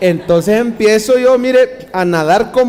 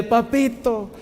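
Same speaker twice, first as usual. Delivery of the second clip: tender papito; 4 semitones higher, 5 dB quieter, much slower than usual. Shown as a demonstration. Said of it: "Dígale".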